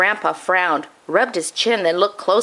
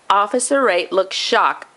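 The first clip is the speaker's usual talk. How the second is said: ordinary delivery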